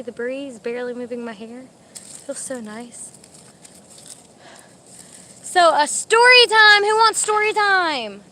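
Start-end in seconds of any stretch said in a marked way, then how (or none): 5.4-8.2 high, drawn-out teaser; singsong announcement. Real, not acted. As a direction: dangle a treat before the audience in singsong. Offering viewers a story.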